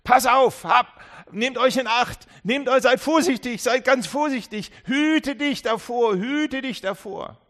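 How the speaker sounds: Jesus calling out loudly to be careful; urgent warning. Acted. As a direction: plead with them to take care. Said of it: "Jesus sagt".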